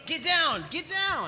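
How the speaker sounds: high urgent cry; frantic duck-and-cover warning. Acted. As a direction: warn them to take cover. Warning teammates under fire.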